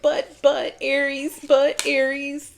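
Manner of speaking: high, hammed-up tearful whimper; mock sobbing plea; mock crying plea. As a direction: whine it like a dragged-away weeping lover. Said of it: "they're crying".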